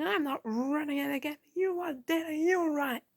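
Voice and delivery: put-on voice for a defiant character's outburst; acted outburst. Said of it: "little outbursts that she had".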